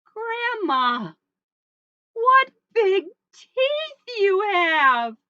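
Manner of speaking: loud, high, stretched-out amazement; astonished little girl. Acted. Little Red spots Grandma's teeth, loud and astonished. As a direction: build it up high and slow.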